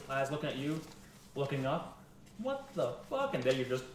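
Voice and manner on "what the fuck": high-pitched disbelief; baffled bystander. Acted. People looking up at the sky, high bewildered exclamation. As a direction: gasp it out high, in utter bewilderment.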